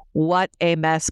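ordinary talk, nothing marked

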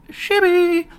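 high, slow shibby; movie catchphrase. Retold or imitated. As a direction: squeak shibby up high like the film. Quoting a favourite movie line.

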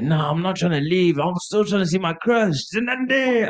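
high whining refusal, rising to a wail; imitated drunken protest. Drunk friend refusing to leave without his crush, mock high wail.

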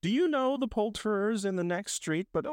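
high, excited questioning; eager inquiry. A narrator's reading. Scrooge asking the boy about the poulterer, eager. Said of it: "Scrooge inquired".

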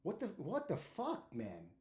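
high, slow, drawn-out mock concern; a high, slow, lightly mocking friend's voice; imitating buddy. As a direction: coax a hurt friend to settle down, hamming it up. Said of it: "He's like".

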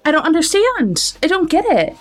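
high-pitched, lightly acted incredulous protest; mock exasperation. Meredith challenges Shelby over her text messages, mock exasperated. Retold argument between two women.

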